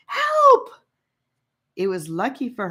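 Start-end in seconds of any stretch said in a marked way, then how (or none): 0.1-0.7 high, loud frightened yelling for help; panicked yelp. Acted. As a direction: scream for the boys to save her. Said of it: "she yelped".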